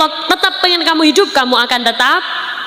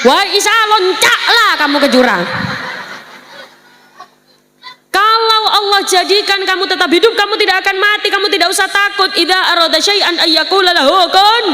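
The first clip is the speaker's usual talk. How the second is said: tempting devil; 7 semitones higher than usual. Acted. Devil tempting Isa, Madurese.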